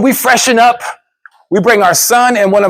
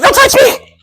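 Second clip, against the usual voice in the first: much higher, tense wife voice, pulling away; mock panicked wife; 4 dB louder than usual. Wife recoiling from her husband's hand, high mock panic. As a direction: squeal it like a scared wife. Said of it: "She's like".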